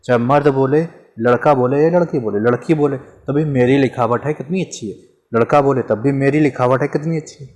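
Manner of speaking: normal talking voice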